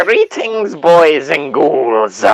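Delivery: high cackling horror host greeting; Crypt Keeper impression. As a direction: stretch it out slow like a ghoulish host. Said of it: "Crypt Creeper impression".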